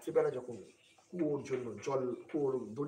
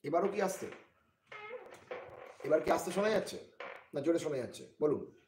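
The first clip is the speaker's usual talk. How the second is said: louder, higher testing question; raised voice; 5 semitones higher, 4 dB louder than usual. Real, not acted. Streamer asking if it is still faint, raised voice. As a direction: project to check the microphone.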